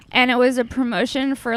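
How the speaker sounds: usual speaking voice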